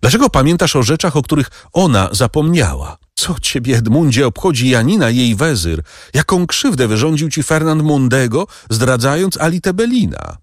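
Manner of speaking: wyższy, szybki, gorący wyrzut kobiety; wzburzona Mercedes. Acted. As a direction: zasyp go pytaniami z żalem. Mercedes reproaches the Count. (Mercedes z wyrzutem pyta Edmunda, wyżej i szybko)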